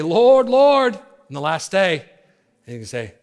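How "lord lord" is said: high, drawn-out cry; loud acted plea. Many crying to the Lord on the last day, loud high plea. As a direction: call out as one of the many, much higher and louder. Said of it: "Many are gonna say".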